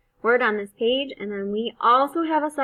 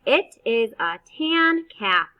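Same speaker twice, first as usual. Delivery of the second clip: fast way; 2 semitones higher, 7 dB louder than usual. Shown as a demonstration. Teacher reading the sentence the fast way. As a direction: read smoothly without sounding out. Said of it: "Fast way".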